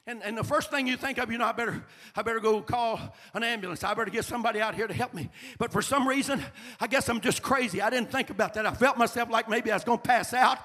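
high, loud climactic sermon shout; shouted preaching. Acted. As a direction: build to a roaring climax. Preaching full force, shouting.

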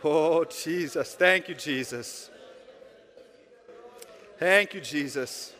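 loud, slow, drawn-out prayer cry; fervent prayer. Real, not acted. Pastor leading the church in prayer, loud fervent cry. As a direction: pour it out to Jesus, loud and long. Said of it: "Let's try it right now".

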